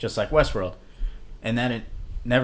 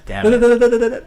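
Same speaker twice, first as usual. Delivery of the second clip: laughing; 12 semitones higher, 11 dB louder than usual. Real, not acted. Laughing frustration at near spoiler.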